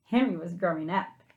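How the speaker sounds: ordinary delivery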